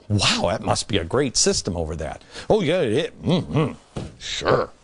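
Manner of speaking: low, dry mock praise; deadpan mockery of the claim; sarcastic. Mocking China's poverty figures, low dry sarcasm.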